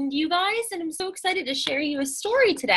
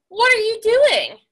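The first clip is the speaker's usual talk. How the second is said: higher, louder rabbit greeting; bouncy hello from a rabbit; perky rabbit; 9 semitones higher, 7 dB louder than usual. Acted. Rabbit voiced in story.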